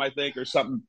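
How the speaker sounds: ordinary delivery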